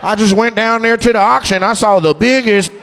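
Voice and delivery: mock auction-house boast; slave trader voice. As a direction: sell it like an auction buyer. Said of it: "why are you talking like a slave trader".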